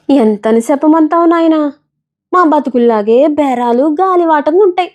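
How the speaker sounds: a tired village woman telling her lot; peanut seller voice. Acted. (పల్లెటూరి అమ్మ స్వరంలో అలసటగా)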